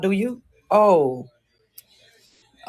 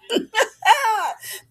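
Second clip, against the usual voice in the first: high laugh. Real, not acted. Laugh after his threat.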